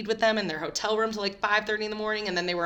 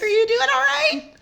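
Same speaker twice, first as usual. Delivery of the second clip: fake concern; 8 dB louder than usual. Retold concerned greeting, acted.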